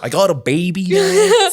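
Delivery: mock Queens Italian tough guy; Joey's Queens Italian accent. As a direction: do a fond Queens Italian impression. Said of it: "quintessential Queens Italian accent".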